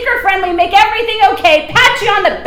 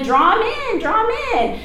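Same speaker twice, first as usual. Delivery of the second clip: mocking; 5 semitones higher, 5 dB quieter than usual. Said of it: "it draws people in".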